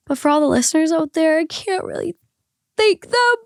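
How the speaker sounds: choking up with gratitude; tearing up. Real, not acted. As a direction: voice climbing high as tears take over. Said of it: "I'm gonna cry".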